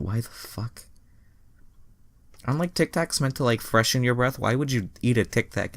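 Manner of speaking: can't believe what you read; incredulous. Real, not acted. Incredulous aside.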